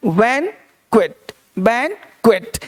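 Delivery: बैंक्वेट slowly, in two parts with a final T; model pronunciation. Shown as a demonstration. Teacher shows students the correct reading, slow and syllabified. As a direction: sound out बैंक्वेट slowly, ending on a clear T. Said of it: "तो इसको पढ़ना है".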